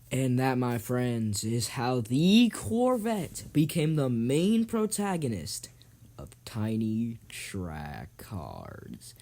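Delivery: slow, drawn-out sign-off; grand closing narration. Acted. Host wrapping up the race story, grand slow narration. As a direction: stretch it out and sink at the end.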